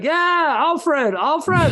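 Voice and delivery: very high, loud eager insistence; squeaky-high mock enthusiasm; driver caricature, retold. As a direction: act out an over-eager driver confirming. Acts out shady replacement driver.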